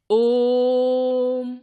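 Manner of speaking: ओम held three times as long, one steady tone; drawn-out om. Shown as a demonstration. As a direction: stretch the vowel in ओम like a chant. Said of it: "इन स्वरों के उच्चारण में तिगुना समय लगता है".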